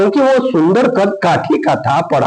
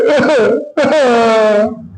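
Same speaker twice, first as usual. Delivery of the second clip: fake crying wail; 6 semitones higher than usual. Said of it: "रोने लगती है".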